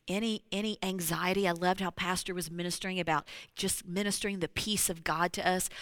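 normal talking voice

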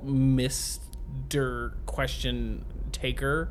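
very slow mock title; slow drawn-out. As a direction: stretch it out grandly. Mock formal reply.

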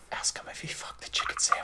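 hushed, unvoiced aside; whispering. Real, not acted. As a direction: whisper it so the guest can't hear. Side comment kept from the guest.

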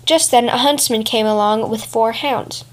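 ordinary delivery, nothing marked

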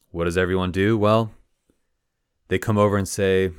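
plain reading voice

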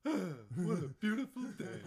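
high, loud happy dog; goofy talking dog loving life. Acted. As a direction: voice a delighted golden retriever. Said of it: "dog voice".

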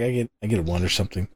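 low, fast mock mumble; mocking dwarf player. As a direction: toss it off as a quick parody. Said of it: "He's probably like".

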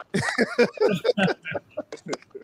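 high-pitched amused laughter; real laugh. Real, not acted. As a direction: laugh at your own forgetfulness. Host laughs at own forgetfulness.

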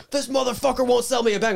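high, loud angry yell; retold yelling. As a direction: complain to the manager at a shout. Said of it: "he's, like, yelling".

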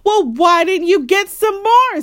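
put-on high, loud grouchy child; bratty demand. A narrator's reading. Grouchy Sister to Mama over missing raisins, bratty demand. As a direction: whine it out loudly at Mama.

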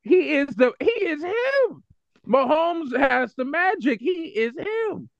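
high-pitched, drawn-out fan plea; worked-up superfan proclaiming his hero; impassioned insistence. Real, not acted. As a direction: stretch the words high in exasperated hype. Heated fan argument defending Mahomes.

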